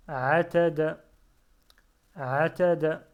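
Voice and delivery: loud, careful model reading of Ta'ala for pupils; Ta'ala said loud and clear, each syllable full. Shown as a demonstration. Teacher reading aloud to learners, loud and careful. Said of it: "teacher models Arabic reading".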